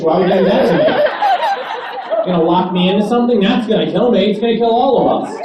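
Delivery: hammed-up outrage; mock rant. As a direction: tear into her, laughing at the absurdity. Ranting at flight attendant.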